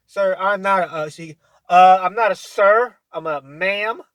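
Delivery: loud, indignant correction; a hammed-up outraged customer; mock offended retort. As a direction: snap back like someone insulted.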